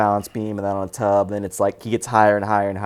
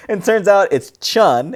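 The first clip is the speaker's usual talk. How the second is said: ordinary talk, nothing marked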